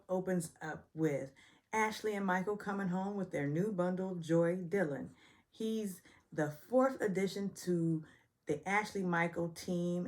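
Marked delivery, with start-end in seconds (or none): none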